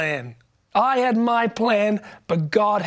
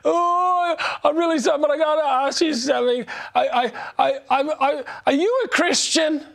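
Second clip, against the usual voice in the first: high-pitched, halting imitation of a tearful girl; crying girl passenger; 6 semitones higher than usual. Crying girl asking the preacher on the plane, high and tearful imitation. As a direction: stammer it out high, as a mock distraught stranger. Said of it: "And she goes".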